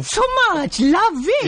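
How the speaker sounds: man's high falsetto auntie; high, singsong gushing auntie; female auntie voice. Acted. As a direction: squeal it like a flattered auntie. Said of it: "from a male voice you change into a female voice".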